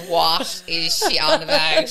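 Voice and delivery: loud, slow, drawn-out incredulous question; mock bafflement. Onlooker reacting to her tale, mock bafflement. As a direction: stretch it out in laughing disbelief.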